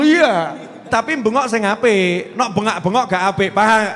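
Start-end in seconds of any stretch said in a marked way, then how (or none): none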